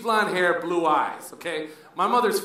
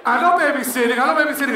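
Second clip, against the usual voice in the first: higher and louder with a laugh; mock sweet stranger's voice; imitated gushing stranger; 6 semitones higher, 6 dB louder, faster than usual. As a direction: gush like a delighted passerby. A person on the street voiced.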